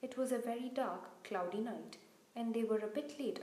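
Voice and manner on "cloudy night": usual speaking voice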